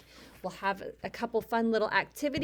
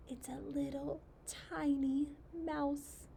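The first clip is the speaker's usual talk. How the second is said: soft, higher voice for something tiny; hushed, tiny voice; 4 semitones higher, 7 dB quieter than usual. Acted. Reader points out a tiny mouse to a child, hushed. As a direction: hush it right down and lift the pitch.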